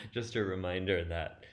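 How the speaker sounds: amused, chuckle still in the voice; laughing. Real, not acted. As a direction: carry the laugh into the words. Laughs at own rambling.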